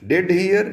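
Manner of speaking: "Did hear" lifted high, rising on "hear"; emphatic stress. Shown as a demonstration. Teacher showing pupils an emphatic verb, stressed. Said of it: "ये stress करने के लिए होता है".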